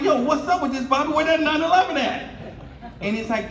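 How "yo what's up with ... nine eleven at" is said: high, loud mock surprise; pitched-up teasing "where that nine eleven at"; teasing disbelief. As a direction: rib your friend about his car. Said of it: "I'm like".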